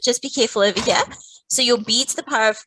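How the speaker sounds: ordinary talk, nothing marked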